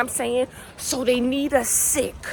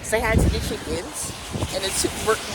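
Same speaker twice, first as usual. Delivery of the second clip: ordinary delivery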